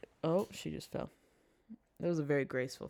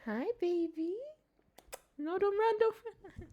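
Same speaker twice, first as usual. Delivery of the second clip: coaxing the cat. Real, not acted. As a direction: plead sweetly with a cat, very high. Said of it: "I do this to get their attention".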